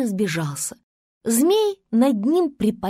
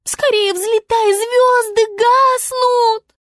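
высоко и громко, медленно на конце; отчаянный вой Звезды; завыла; 11 semitones higher, 6 dB louder, slower than usual. A narrator's reading. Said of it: "завыла звезда". (Звезда в отчаянии воет, протяжно)

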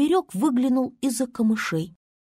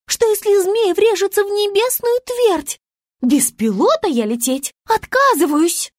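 заметно выше и громче, требовательно; важная звёздочка возмущается; капризная Звезда; 7 semitones higher, 4 dB louder than usual. A narrator's reading. (Звезда требует ремни безопасности, высоко и капризно)

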